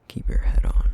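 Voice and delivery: a sudden loud hissed command; loud sharp warning. Acted. Vampire suddenly warning the detective, loud and sharp. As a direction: bark it out to make him flinch.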